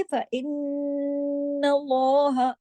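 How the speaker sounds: slow chant of फ़इन्नल्लाह with nasal hum on नल; Quran recitation. Shown as a demonstration. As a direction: recite फ़इन्नल्लाह, holding the doubled न. Recites with ghunna.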